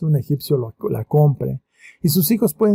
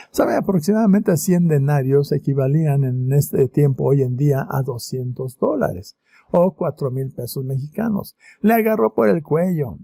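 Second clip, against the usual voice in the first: ordinary delivery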